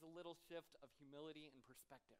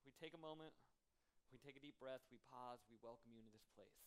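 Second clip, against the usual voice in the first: soft, hushed pastor's prayer growing quieter toward amen; 4 semitones lower, 4 dB quieter than usual. Acted. Pastor leading the congregation in an opening prayer, hushed and reverent. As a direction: pray gently, inviting the room into stillness.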